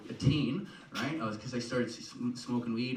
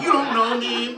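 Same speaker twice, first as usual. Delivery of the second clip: high, loud mock-defiant shout; hammed-up indignant yell; defiant parting yell; 13 semitones higher, 11 dB louder than usual. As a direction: holler it back from the door. Acted parting shout at the door.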